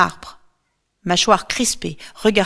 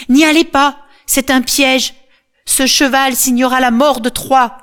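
loud, much higher desperate cry; shouting warning; 6 semitones higher, 6 dB louder than usual. A narrator's reading. Cassandra warns Trojans against the horse, shouting. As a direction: scream the warning at them. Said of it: "crie Cassandre".

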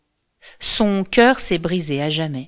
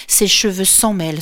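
ordinary delivery

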